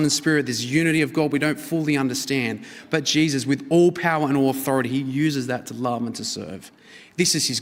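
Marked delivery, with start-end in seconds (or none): none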